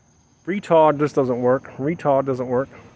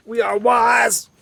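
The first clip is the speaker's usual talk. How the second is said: mock proclamation; 6 semitones higher, much slower than usual. Mock-grand proclamation of wisdom.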